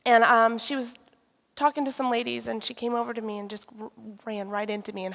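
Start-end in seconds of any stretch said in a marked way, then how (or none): none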